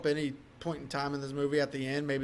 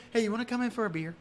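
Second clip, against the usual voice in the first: flirty invite; 4 semitones higher, 5 dB quieter, faster than usual. Retold or imitated. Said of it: "when she goes".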